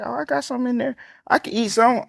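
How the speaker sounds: high, light auntie voice, brushing it off; imitating auntie. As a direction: play the aunt who insists she's fine. Voicing aunt dismissing food.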